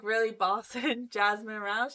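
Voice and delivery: normal talking voice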